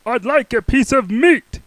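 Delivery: loud high boom. Acted. Mister Noisy booming at the butcher. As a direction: boom it at him. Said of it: "he boomed".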